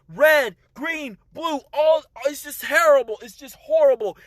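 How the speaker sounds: ordinary delivery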